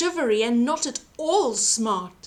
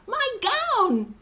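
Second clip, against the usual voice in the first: glad yell; 10 semitones higher than usual. A narrator's reading. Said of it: "he yelled".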